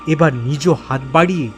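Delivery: ordinary delivery, nothing marked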